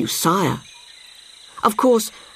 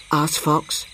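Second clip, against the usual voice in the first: ordinary delivery, nothing marked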